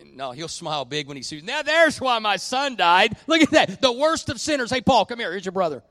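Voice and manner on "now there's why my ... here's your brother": high, loud, delighted cry of welcome; excited Jesus. Retold or imitated. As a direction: shout the joy of seeing the worst sinner arrive. Excited Jesus seeing great sinner.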